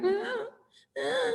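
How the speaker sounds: dog whining while dinner is made, high soft whine. Retold or imitated. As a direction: imitate a fretting dog's whine. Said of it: "you hear".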